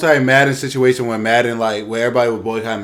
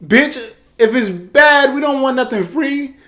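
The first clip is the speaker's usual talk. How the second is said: much higher, louder indignant snap; exasperated outburst; 14 semitones higher, 5 dB louder than usual. Real, not acted. Podcaster ranting back at EA, high indignant outburst. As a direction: blow up in disbelief.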